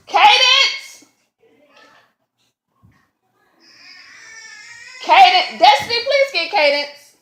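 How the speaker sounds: calling out. Real, not acted. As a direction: yell out to summon someone. Calling kids mid-story.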